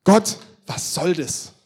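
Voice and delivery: loud, drawn-out cry to God; exasperated outcry. Acted. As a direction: call it out slowly and loudly, demanding an answer. Acts Elia's outcry to God. (lauter, gedehnter Aufschrei zu Gott)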